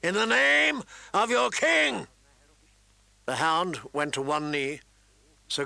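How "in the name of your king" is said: slow, high battlefield bellow; commanding roar with drawn-out words; booming royal command. A narrator's reading. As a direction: boom it out as the king. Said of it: "he boomed".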